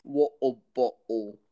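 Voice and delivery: slow British echo with dropped T's; British glottal stops; mock British accent. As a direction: copy a silly British accent, drawn out.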